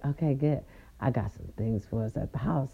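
low, soft and reassuring; soft low reassurance. Retold or imitated. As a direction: soothe her gently. Voicing her man, soft low.

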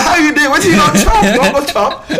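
shouted high pidgin banter; loud Naija greeting, half laughing; loud Nigerian pidgin. Retold or imitated. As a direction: yell it across the room. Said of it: "we are very loud".

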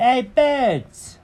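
high-pitched cat hailing the birds; Jenkins calling out. Acted. Cat calls to the birds outside, high voice. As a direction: call out in a high cartoon voice. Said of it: "And he said".

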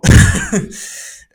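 shrill burst of laughter; high squealing laugh. Real, not acted. Laughs at herself.

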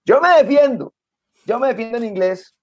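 mock someone bragging about their English; boastful quote. Mocking a man who claims he gets by, high mock brag. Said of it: "alguien que alguna vez me dice".

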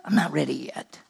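a hushed, unvoiced I'm not ready yet; whispered excuse. Mimicking reluctant believer.

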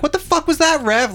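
very high angry cry; kid yelling at ref. Retold or imitated. Ten-year-old cursing at the referee, very high and angry. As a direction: screech it at the ref. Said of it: "I was like".

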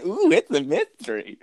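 high, teasing ooh; mock intrigue. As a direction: play up the mystery. Mocking mystery hype.

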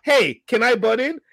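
slow, pointed request meant to be overheard; drawn-out loud ask aimed past me. Retold or imitated. Line cutter asks loudly so I overhear, pointed. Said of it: "they say loudly for me to hear".

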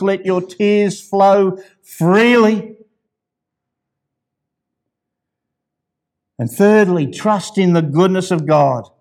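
high, loud, fervent sermon peak, slowing on key lines; full-force preaching. Acted.

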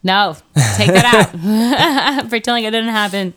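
talking through laughter; amused, giggly protest; laughing plea. Real, not acted. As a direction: laugh while taking it back. Laughing after joke song.

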